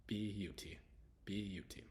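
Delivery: "B U T" as bee-YOO-tee, syllables apart; syllable breakdown. Shown as a demonstration. Teacher breaking beauty down for learners, separated syllables. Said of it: "So B. B E A is B".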